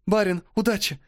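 bright, high excited cheer. Acted. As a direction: cheer him on eagerly. Servant girls cheer him. (радостный возглас)

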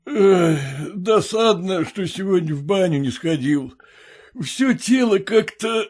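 yawning complaint. A narrator's reading. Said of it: "начал было он и не докончил фразы".

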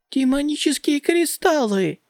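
high, excited squeal of delight; joyful shriek. Acted. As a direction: squeal it out with glee. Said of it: "Диалан завизжала от радости".

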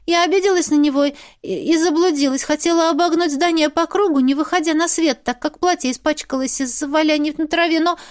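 high-pitched, flustered confession; embarrassed rambling. A narrator's reading. Journalist explains why she got lost, embarrassed.

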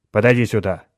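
loud summons to his son; calling out. A narrator's reading. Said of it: "позвал отец". (громко подзывая сына)

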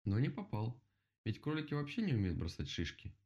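plain reading voice

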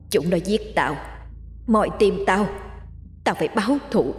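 a vengeful spirit's threat, lightly voiced; demon roaring. A narrator's reading. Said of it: "rồi gầm lên". (giọng quỷ báo thù, nhẹ, cao dần)

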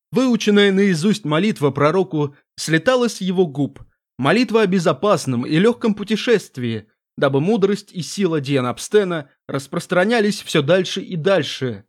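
plain reading voice

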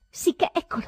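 loud feverish outburst; loud exclamation. A narrator's reading. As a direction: cry out Sì che eccolo in a high loud burst.

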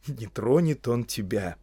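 ordinary delivery, nothing marked

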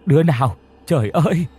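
high, loud, anguished screaming; screaming wail. A narrator's reading. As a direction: grandmother screams over the broken urn. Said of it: "tiếp tục gào rú". (gào rú to và cao)

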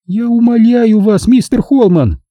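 high, slow feminine jeering; mocking. A narrator's reading. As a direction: scoff with exaggerated pleading. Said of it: "с издёвкой в голосе". (с издёвкой, женским голосом)

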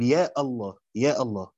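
يا الله with a glottal stop before "Allah"; cut hamza. Shown as a demonstration. Teacher shows the hamza in vocative, glottal stop opening الله. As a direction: break between يا and الله, starting الله on a hard hamza. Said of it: "إن همزة وصله في النداء تقطعها".

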